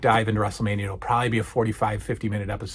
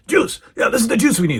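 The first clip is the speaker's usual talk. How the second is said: much higher, emphatic celebrity impression; Mister T; 9 semitones higher, faster than usual. Mister T picking up juice in the store, insistent impression. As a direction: announce it in a pushy, raised mock voice. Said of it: "Mister T picks up".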